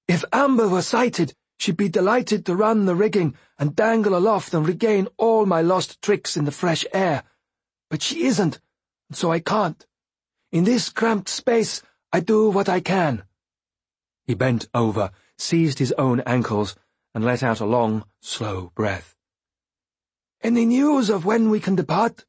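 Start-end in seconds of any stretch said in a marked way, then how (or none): 0.1-13.2 higher, lighter voice for the Fool; Fool's voice. A narrator's reading. 20.4-22.2 higher, lighter voice for the Fool; Fool's voice. A narrator's reading.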